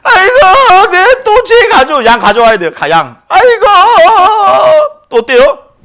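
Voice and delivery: high, loud crying wail; despairing sinner. Retold or imitated. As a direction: whine about needing another lamb. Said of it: "죄에 대해서 고민하게 되는". (또 양을 바쳐야 하는 죄인의 울부짖음)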